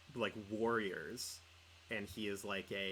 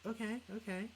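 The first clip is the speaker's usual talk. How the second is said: higher, a bit thrown; high mock okay-okay; mock taken aback; 11 semitones higher than usual. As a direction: take it in, slightly startled. Retold reaction to DJ's answer.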